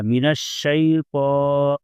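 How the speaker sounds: من الشیطان slow, with a full heavy ta; heavy letter. Shown as a demonstration. Teacher demonstrating tajweed, slow and heavy. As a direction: give الشیطان a thick, heavy sound. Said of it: "وا کو موٹا پڑا جائے گا".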